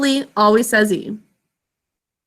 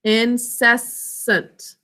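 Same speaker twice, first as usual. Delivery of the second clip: "incessant" very slowly, syllables apart; much slower than usual. Shown as a demonstration. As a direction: sound out "incessant" for spelling. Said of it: "Next syllable".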